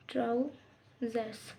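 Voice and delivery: "ट्राउजर्स" said slowly, the final s clearly sounded; careful pronunciation. Shown as a demonstration. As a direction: spell "ट्राउजर्स" out slowly for the class. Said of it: "ट्राउजर्स में हम pants ले लेंगे".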